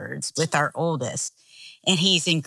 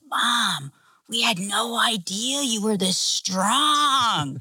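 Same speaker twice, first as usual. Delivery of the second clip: put-on slow, higher voice of an awed little kid; youngest child; 5 semitones higher, slower than usual. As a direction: imitate a child marveling at mom. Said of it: "our youngest is like".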